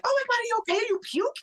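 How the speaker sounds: high-pitched retold panic; freaking out. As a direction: re-enact an alarmed wife checking on him. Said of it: "freaking out".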